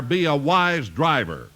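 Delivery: ordinary talk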